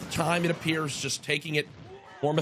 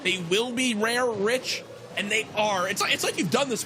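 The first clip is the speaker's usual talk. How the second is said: play-by-play hype; 5 semitones higher than usual. Acted. Caster's hyped wrap-up.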